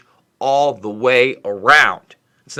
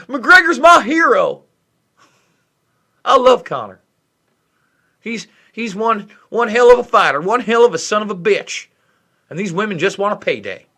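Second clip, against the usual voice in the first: mocking fanboy in a bad Southern accent; 6 semitones higher, 4 dB louder than usual. Mocking a McGregor fanboy in a bad Southern accent. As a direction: ham up a bad Southern accent, louder and higher. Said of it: "a bad Southern accent".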